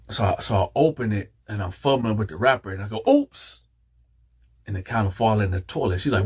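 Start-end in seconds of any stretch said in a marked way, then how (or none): 3.1-3.6 very high-pitched little oops; mock accidental oops. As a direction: feign a clumsy slip. Acts out dropping it in toilet.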